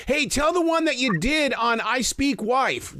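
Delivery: high, slow mock-heckler call from the crowd. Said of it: "someone says".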